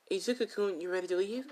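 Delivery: put-on high, sugar-coated call to the boyfriend; sugary sweet call. Rose sweetly calls her boyfriend.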